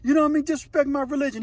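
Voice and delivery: high-pitched retold anger; acted indignant protest. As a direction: push back as the insulted inmate. Acts out offended inmate's protest.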